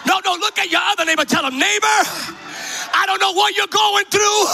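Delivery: high, urgent revival exhortation; rousing preaching. Real, not acted. Preacher tells the congregation to exhort their neighbors, high impassioned call. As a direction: fire up the crowd, phrase by phrase.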